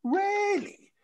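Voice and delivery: drawn-out, very high questioning; mock incredulity. Woman doubting the refusal to move, high incredulous mimicry. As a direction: imitate her disbelief in a high pitch.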